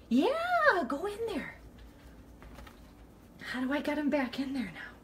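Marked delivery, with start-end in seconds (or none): 0.1-1.5 high little encouraging voice; high-pitched coaxing. Real, not acted. Woman urging mouse back in there, high coaxing. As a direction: coax it along in a high voice.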